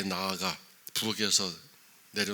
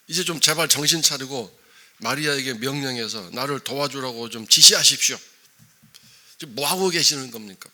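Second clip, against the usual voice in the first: loud, higher exasperated demand; Martha ordering Jesus; 5 semitones higher, 12 dB louder than usual. Retold or imitated. Martha tells Jesus to order Mary to help, loud exasperated demand. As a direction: order him to come to his senses. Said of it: "예수님을 야단치고 꾸중하는 상황입니다".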